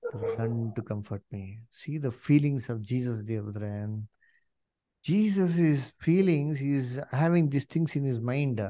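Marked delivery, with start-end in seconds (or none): none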